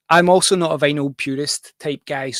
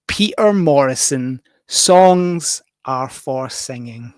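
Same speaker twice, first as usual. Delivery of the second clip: title announcement; 7 dB louder, much slower than usual. Real, not acted. Announcing the first record on the show.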